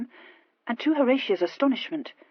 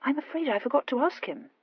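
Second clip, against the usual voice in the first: higher, mock-innocent musing; pensive; 7 semitones higher than usual. A narrator's reading. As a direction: admit it as a mild afterthought. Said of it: "said the Earl pensively".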